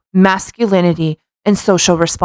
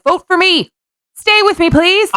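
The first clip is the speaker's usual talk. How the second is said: high, pleading campaign voice; mock campaign plea; 12 semitones higher than usual. Mocking a cult leader begging members to stay, high and pleading. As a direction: beg for votes in a hammed-up high voice. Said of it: "it's like a presidential speech".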